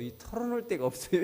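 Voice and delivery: mild mock lament about having no one to confide in; whiny complaining man. Church member telling the pastor he has nobody, mock whine. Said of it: "이렇게 얘기해요".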